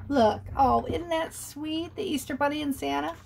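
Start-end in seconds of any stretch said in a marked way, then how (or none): none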